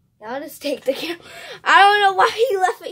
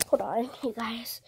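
whispering; 7 semitones lower, 12 dB quieter than usual. Real, not acted. Sneaking while searching.

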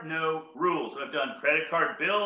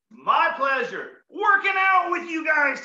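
excited thanks; 9 semitones higher, 5 dB louder, slower than usual. Real, not acted.

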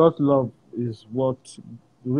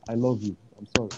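soft, low loving apology; tender father; 4 semitones lower, 3 dB quieter than usual. Retold or imitated. Father apologizing to his son, tender and soft. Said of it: "he said".

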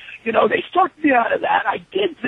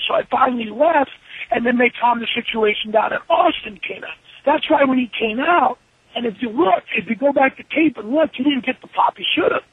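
normal talking voice

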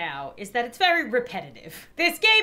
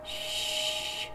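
mock hushing; 3 semitones lower, 5 dB quieter, much slower than usual. Hushing the game baby.

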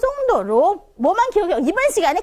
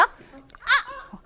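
아 short and high, falling at once; startled fourth tone; 10 semitones higher, 6 dB quieter than usual. Shown as a demonstration. Teacher acts out being startled, abrupt falling 아. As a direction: yelp 아 as if someone stepped on your foot. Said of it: "놀랐을 때 어떻게 얘기해요?".

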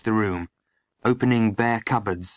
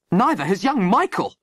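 high-pitched angry outburst; indignant explosion; 9 semitones higher, 4 dB louder than usual. A narrator's reading. Landlady cutting in on the sergeant, indignant explosion. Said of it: "Molly exploded".

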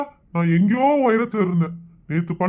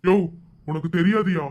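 melodramatic film dialogue; 6 semitones lower than usual. Acted. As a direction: play it like a tragic movie hero mourning his sister. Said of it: "இந்த சூரிய வம்ச டயலாக்லாம்".

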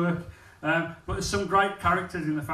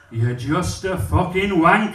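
blunt insult; 2 semitones higher, 8 dB louder, slower than usual. Retold or imitated. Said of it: "looks me up and down and goes".

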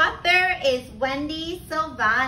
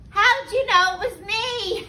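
high-pitched storybook howl; mock howling; 8 semitones higher than usual. A narrator's reading. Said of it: "howled Turkey".

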